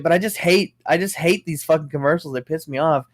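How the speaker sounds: ordinary delivery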